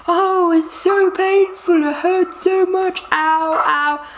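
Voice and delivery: high, slow, whiny mock moaning; mocking whiny woman in labour. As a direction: ham up a melodramatic whinger. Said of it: "She's like".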